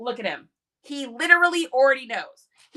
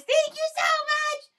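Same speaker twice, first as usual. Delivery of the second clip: high squeaky dog voice; voicing the dog. Jack Jack the dog thanking the tipper, high dog voice. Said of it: "Jack Jack says".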